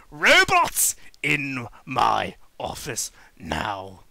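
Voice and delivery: low, slow menacing command; stern villain order. Acted. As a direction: summon them coldly. Robotnik summons robots.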